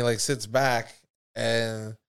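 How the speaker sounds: normal talking voice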